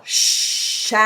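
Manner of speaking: "Shall" with a wide open vowel. Shown as a demonstration. Coach models open vowel in shall. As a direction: give "Shall" lots of vowel space. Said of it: "Give space for your vowel".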